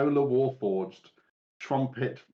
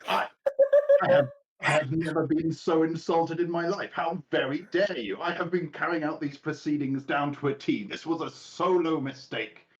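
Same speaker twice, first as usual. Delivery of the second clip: higher, louder offended outburst; hammed-up scandalised huff; indignant servant; 6 semitones higher, 3 dB louder than usual. Servant insulted by the performers, indignant. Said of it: "servant response".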